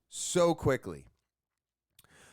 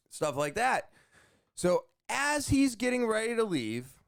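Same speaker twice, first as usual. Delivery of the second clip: ordinary talk, nothing marked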